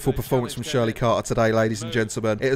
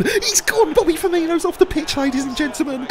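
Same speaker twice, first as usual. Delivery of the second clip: much higher, announcing a sending off with glee; gleeful high announcing. Real, not acted. Commentator to viewers on a red card, gleeful high call. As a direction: crow it like a stadium announcer. Player sent off, gloating.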